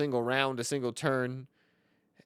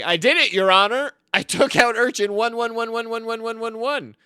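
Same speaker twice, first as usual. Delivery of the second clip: high, loud courtroom declaration; hammed-up victorious shout, pitched up; triumphant confession; 11 semitones higher, 9 dB louder than usual. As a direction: crow it loudly to the judge. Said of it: "in the middle of a role play".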